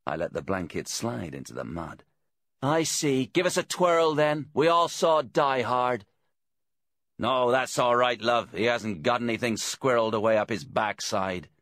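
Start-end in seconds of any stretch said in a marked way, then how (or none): 2.6-11.5 raised, loud, mocking policeman; Deeks. A narrator's reading. Bent detective taunts the man outside the caravan, mocking. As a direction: jeer at him in a higher, louder voice. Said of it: "came the much-loathed voice of Detective Inspector Deeks".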